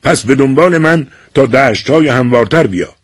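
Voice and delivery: stage line shouted against the wind; calling over the wind. A narrator's reading. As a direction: call it out over the howling wind. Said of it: "با صدای بلند در میان باد فریاد زد".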